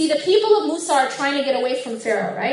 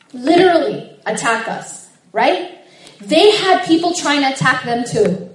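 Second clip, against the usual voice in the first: loud, rising passionate sermon; fiery preaching; 5 dB louder than usual. Acted. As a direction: preach it with swelling conviction. Sermon builds to full force.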